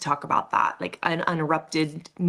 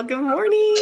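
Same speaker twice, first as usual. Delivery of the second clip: cheery greeting; 11 semitones higher, 5 dB louder than usual. Real, not acted. Bright, loud morning greeting.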